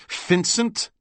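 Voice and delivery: sneering imitation of the German saying "Vincent"; mocking German accent. DeMarco mocks the old German's accent back at him, high and rising. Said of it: "mocking the German's accent".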